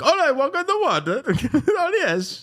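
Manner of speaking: very high, squeaky mock foreign accent; hammed-up falsetto comic foreigner; Borat. Host mocking walking on water in Borat's voice. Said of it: "Guy, guy sounds like Borat".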